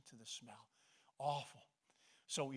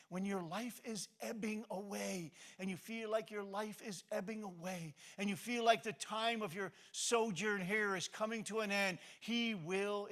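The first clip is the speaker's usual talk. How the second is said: loud, high preaching climax; fervent preaching; 8 semitones higher, 11 dB louder than usual. Acted. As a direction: preach it out, swelling to the peak.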